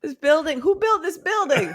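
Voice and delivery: high-pitched hammed-up indignation; mock outrage. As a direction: blame the builders in a high, scandalized voice. Acted drunken character's complaint.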